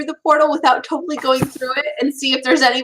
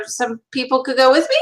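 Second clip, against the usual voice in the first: really high; 2 semitones lower than usual. Real, not acted. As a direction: voice shooting up into a squeak at the end. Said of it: "ever heard it that go that high".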